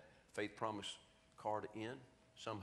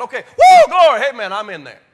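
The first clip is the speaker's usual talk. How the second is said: ecstatic shout, very high and loud; shouting in church; 14 semitones higher, 30 dB louder than usual. Retold or imitated. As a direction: whoop it up like the congregation. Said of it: "Everybody else is shouting".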